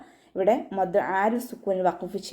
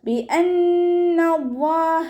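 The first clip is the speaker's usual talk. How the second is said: slow, high, melodic tajweed chant; Quran recitation; 9 semitones higher, 5 dB louder, much slower than usual. Shown as a demonstration. Teacher chants the verse for students, slow and melodic. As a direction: draw each syllable out in a sung recitation.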